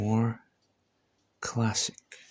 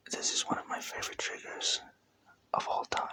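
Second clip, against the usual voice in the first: soft unvoiced ASMR whisper; 4 dB quieter than usual. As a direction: breathe the words close to the mic.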